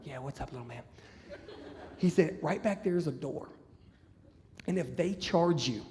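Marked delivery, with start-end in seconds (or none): 0.0-0.8 low, very soft confidential voice; acted quiet aside; hushed reply. Confidential reply to small guard. 2.4-5.8 secretive warning. Retold or imitated. Guard secretly gives escape instructions.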